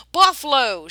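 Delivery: loud high alarm; shout. A narrator's reading. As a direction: yell the warning to the coach. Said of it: "shouted the driver".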